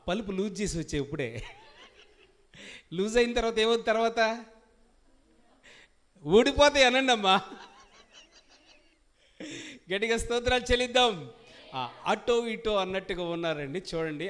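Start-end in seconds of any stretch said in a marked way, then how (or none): 2.9-11.3 loud, higher call to respond; fiery preaching. Acted. Pastor urges the congregation to shout praise, full-force preaching. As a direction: rouse the congregation to answer.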